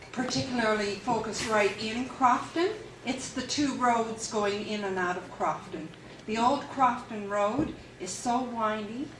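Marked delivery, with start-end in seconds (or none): none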